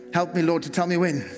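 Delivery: ordinary delivery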